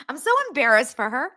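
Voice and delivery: high, fast cringe; embarrassed squeal. Real, not acted. Streamer cringing for the actress, in a high squeal.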